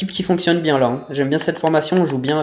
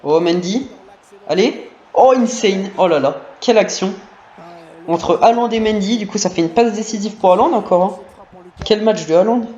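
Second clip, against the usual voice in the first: esports caster; 4 semitones higher than usual. Real, not acted. Commentating his own attack live.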